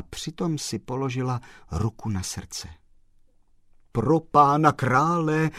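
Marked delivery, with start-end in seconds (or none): 3.9-5.5 loud, drawn-out cry of dismay; exclaiming. A narrator's reading. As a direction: exclaim slowly, pitched up and loud. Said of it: "zvolal pan Kolbaba". (zvolat hlasitě a protáhle)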